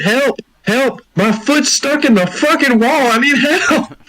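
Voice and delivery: high, slow mock wail for help; high-pitched hammed-up distress call; re-enacted screaming. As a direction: re-enact screaming with a stuck foot. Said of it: "I was screaming for help".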